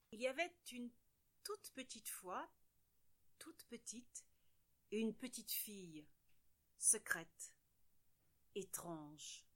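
soft, near-whispered mystery; hushed storytelling. A narrator's reading. Narrator opening a secret tale, hushed whisper. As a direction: draw listeners in with a secret hush. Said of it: "mysterious story opening".

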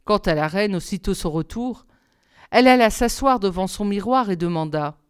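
ordinary delivery

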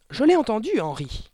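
high, loud, fearful protest; quavering yelp. A narrator's reading. Mother insisting to her husband, quavering yelp. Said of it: "glapit la mère d'une voix toujours aussi chèvrotante".

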